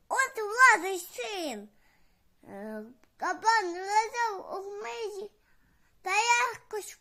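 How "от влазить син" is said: louder, higher, slow frightened gasp; scared animals. Acted. Hiding animals panic at the cat's meow, loud and slow. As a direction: let the fear rise in a loud slow line. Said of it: "А ті думають".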